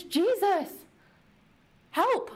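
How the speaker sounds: high, strained cry for help; desperate plea. Retold or imitated. Lepers crying out to Jesus, desperate and high.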